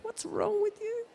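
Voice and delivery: very high, slow sing-song coo; cooing baby talk. Retold or imitated. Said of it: "you're smiling at them and you're going".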